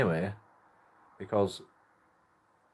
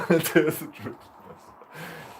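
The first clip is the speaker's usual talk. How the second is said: laughing echo; 8 semitones higher, 4 dB louder, much slower than usual. Real, not acted. Laughing echo of own line.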